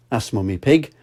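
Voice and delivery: plain reading voice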